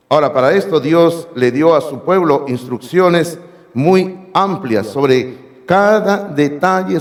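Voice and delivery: ordinary delivery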